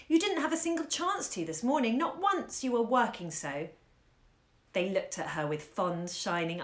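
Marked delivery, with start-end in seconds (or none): 0.1-3.7 put-on lightly higher little-girl voice; sweetly coaxing child. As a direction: Caroline coaxing her tired mother, a touch higher. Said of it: "in her little girl bird voice".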